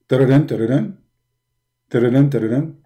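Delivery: sing-song ringtone noise; phone ring imitation. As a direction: mimic a phone ringing, rising.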